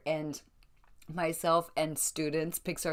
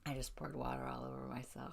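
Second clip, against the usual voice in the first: whisper; 3 semitones lower, 11 dB quieter than usual. Real, not acted. Aside after spilling water.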